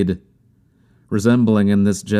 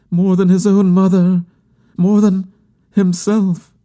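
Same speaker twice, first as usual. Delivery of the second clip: very high, louder, fervent lament; impassioned grieving woman; 13 semitones higher, 4 dB louder than usual. A narrator's reading. Bereaved woman insisting she believed in him most, high and impassioned. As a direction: pour out devotion in a high, pleading voice.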